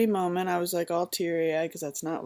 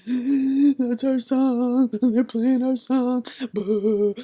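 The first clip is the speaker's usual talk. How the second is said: high, loud, very slow, tearful wail; retold bawling; 6 semitones higher, 7 dB louder, much slower than usual. Mother hears their wedding song and starts sobbing. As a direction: wail it through mock tears. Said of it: "I start bawling my face off".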